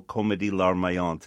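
"comédie larmoyante" said in French, nasal French vowels; French pronunciation. Shown as a demonstration. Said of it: "what the French call".

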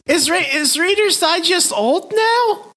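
very high, drawn-out incredulous question; aghast, pitch shooting way up; astonished disbelief. Real, not acted. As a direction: squeak it out, slowly, in real disbelief. Taken aback at being dated.

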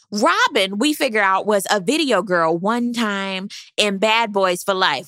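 normal talking voice

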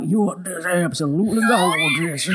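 hammed-up wheezy southern drawl; a mock southern gent oozing over a waitress. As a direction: lay on a thick southern drawl, mock creepy old man. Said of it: "so that would be more like".

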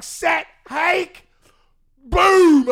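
high loud shouted count, then a big boom; retold quarterback count ending in a shout; snap call.

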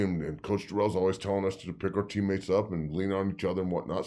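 usual speaking voice

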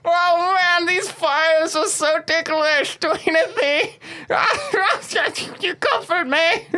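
high giggly voice; being tickled. Acted. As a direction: laugh helplessly while burning. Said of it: "just cackling".

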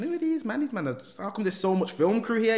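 high-pitched baffled inner voice; retold bewilderment. Retold bewildered thought at film crew.

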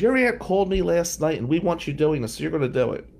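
high mock teacher voice laying down the law; insistent teacher. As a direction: imitate the teacher who won't take no. Teacher says aunt called.

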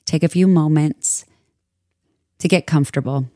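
calm, measured meditation voice with long pauses; soothing yoga teacher guiding a meditation; guided meditation. Acted. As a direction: ease listeners into stillness, unhurried and even.

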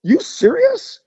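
retold shock; surprised. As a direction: caught off guard, half disbelieving. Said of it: "I go".

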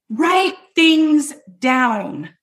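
write things down, drawn out very slowly; slow emphasis. Shown as a demonstration. Coach stressing the tip to her students, very slow. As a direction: spell out write things down word by word. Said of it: "repeats the tip slowly".

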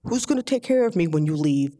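a hint of a low-voiced father; lightly voiced father. Father answering his daughter's diet plea, lightly voiced. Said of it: "And he said".

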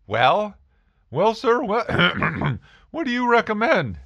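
high, cracking voice, clearing throat; teen asking pharmacist, voice cracking with nerves; nervous stammer. Retold or imitated. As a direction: stumble nervously through it.

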